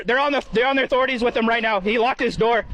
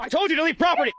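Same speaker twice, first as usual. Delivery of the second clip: firm raised command; stern shout; 7 semitones higher than usual. Real, not acted. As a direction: order him off the property. Guard orders trespasser to leave.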